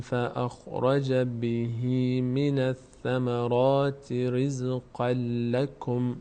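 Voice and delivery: slow, measured tajweed chanting; unhurried, sustained recitation; Quran recitation. Shown as a demonstration. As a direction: hold the long vowels and nasals on an even note. Teacher recites verse.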